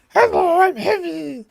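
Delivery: very high, drawn-out silly impression; Heavy voice. Gamer showing viewers how a game character sounds, high silly voice. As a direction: pipe it up high, holding the last word. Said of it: "Where did the heavy voice come from?".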